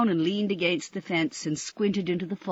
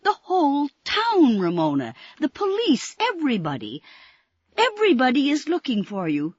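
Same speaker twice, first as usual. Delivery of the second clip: high, loud, tumbling mailman voice; excited mailman; 9 semitones higher, 6 dB louder than usual. Acted. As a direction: blurt it out in excitement. Excited mailman blurts the news.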